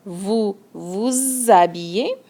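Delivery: vous vous habillez said slowly with a clear z joining vous and habillez; vouZ-abillez, linked and drawn out; liaison shown. Shown as a demonstration. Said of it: "Make sure you make a little liaison here".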